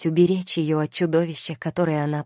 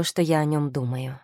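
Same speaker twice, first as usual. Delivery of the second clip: plain reading voice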